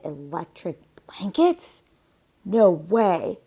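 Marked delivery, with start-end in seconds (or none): 2.4-3.4 firm refusal. A narrator's reading. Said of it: "said Farmer Brown".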